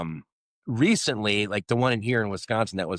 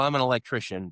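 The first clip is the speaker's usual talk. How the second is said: ordinary talk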